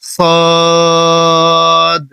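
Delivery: সদ drawn out with a final bounce; correct Arabic letter. Shown as a demonstration. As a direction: stretch সদ long and end with a push. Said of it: "আপনাকে বলতে হবে". (সদ টেনে শেষে বাড়ি দিয়ে)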